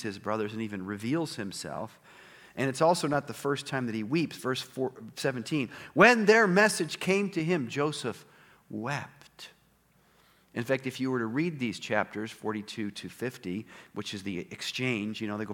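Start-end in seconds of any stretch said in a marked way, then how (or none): 5.9-9.5 lightly raised reading voice; scripture reading. Acted. Pastor rereading the verse to the congregation, lightly lifted. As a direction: quote the verse again, a little lifted.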